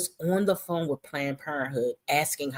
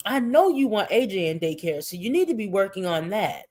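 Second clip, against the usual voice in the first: normal talking voice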